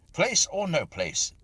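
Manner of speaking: loud, high, slow angry outburst. A narrator's reading. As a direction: snap back, fed up. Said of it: "I said angrily".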